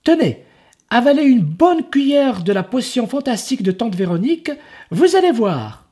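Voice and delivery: put-on high, quick pushy kid's voice; eager boy salesman. Enzo urging the ogre to swallow, high and fast. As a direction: sell the potion fast and cheerful.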